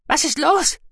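high, piping alarmed mousebeaver; alarmed Guki. A narrator's reading. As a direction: ask urgently in a very high voice. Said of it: "fragte Guki alarmiert". (hohe Mausbiberstimme, alarmiert)

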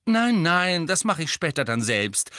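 beaming refusal. A narrator's reading. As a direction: wave it off happily. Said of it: "strahlte Misses Weasley".

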